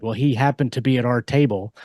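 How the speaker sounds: ordinary talk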